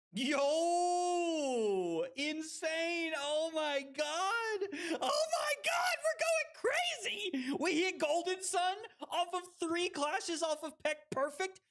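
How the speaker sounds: high, hyped-up streamer celebration; real high-pitched hype; ecstatic yelling. Real, not acted. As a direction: lose it over an unbelievable win. Streamer celebrating a big game moment.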